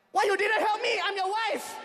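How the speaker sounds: scolding like an aggrieved wife; mock upset wife. Wife scolds husband for not helping, mock indignant outburst. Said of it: "my wife was like".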